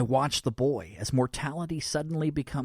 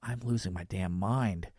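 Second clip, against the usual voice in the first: usual speaking voice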